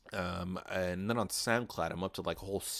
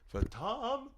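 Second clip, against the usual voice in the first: high, fading imitation of an echo; mock echo; 5 dB quieter, much slower than usual. Host announcing his next episode's title, mock echoing. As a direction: repeat each word like a high, softer echo.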